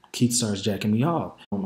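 normal talking voice

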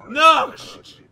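put-on high, very loud Russian-accented call; shouting to the next person. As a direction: bellow it down the queue. Border officer calling the next person.

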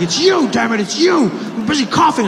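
high, loud frantic accusation; accusing shout. Retold or imitated. Scared passenger accusing Trevor of Ebola, high frantic shout. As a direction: point at him and yell.

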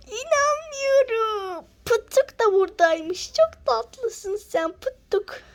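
high, slow, squealing grandma; grandma swooning over a puppy; delighted granny. Acted. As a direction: gasp and coo over the puppy like a doting granny.

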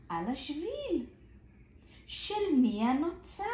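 lively storybook reading with big swings; reading to children. Acted. Teacher reads a picture book to small children, lively and expressive. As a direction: read it out like a kindergarten story.

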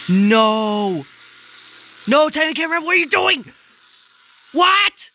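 high, loud shocked yells; distraught shouting at the screen; horrified screaming. Real, not acted. Horrified as the fight turns.

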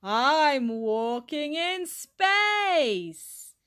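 very drawn-out, floaty call; slow-motion astronaut. A narrator's reading. Dylan pretending to walk in space, slow-motion cry. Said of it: "in super slow motion".

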